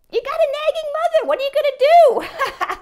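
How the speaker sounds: high, loud, laughing jab at herself; laughing self-mockery. Mother joking about her nagging to her son, loud laughing. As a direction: cackle it out as a joke on herself.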